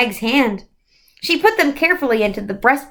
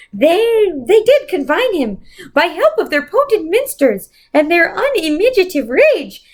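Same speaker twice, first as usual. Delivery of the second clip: Mrs Who quoting; 7 semitones higher than usual. A narrator's reading. Mrs Who quotes The Tempest to Calvin, high and declamatory. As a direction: declaim Shakespeare like an ancient spirit.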